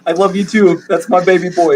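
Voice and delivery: high cooing father; tender dad. Acted. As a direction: tuck your son into bed. GM plays the father.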